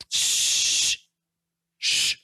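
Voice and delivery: long, drawn-out "shh"; sustained shushing sound. Shown as a demonstration. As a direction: hush with one sustained hiss. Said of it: "tiene una acentuación diferente".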